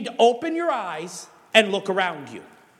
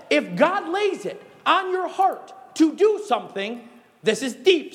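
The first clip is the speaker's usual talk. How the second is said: ordinary talk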